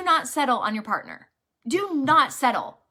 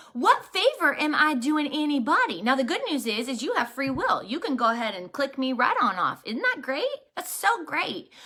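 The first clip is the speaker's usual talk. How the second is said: normal talking voice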